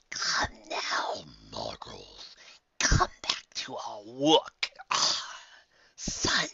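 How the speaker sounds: put-on breathy, hushed old entity; whispered voice of the ancient Allfather; whispery ancient Allfather. A narrator's reading. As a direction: speak like an ancient creature from the shadows.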